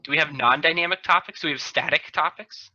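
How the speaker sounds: ordinary talk, nothing marked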